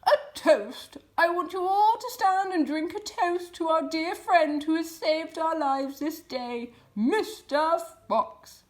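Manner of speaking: high, loud, hearty toast from old Badger; proud old Badger proposing a toast; Badger calling out. A narrator's reading. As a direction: raise the glass and boom it out. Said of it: "called out".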